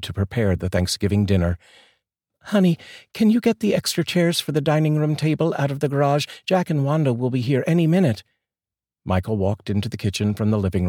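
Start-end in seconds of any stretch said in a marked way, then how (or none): 2.4-8.2 high, raised female voice; wife calling husband for chairs; wife asking. Acted. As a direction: call him from the kitchen.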